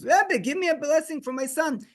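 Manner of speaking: high, imploring retold appeal; tearful plea. Father asking the Rebbe for a blessing, pleading. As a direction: plead on a sustained high pitch, no real sobs. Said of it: "he starts crying".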